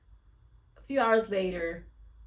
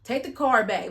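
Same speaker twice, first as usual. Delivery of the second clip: breaking it to him; 3 semitones higher, 7 dB louder than usual. Retold or imitated. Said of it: "she break it to him like".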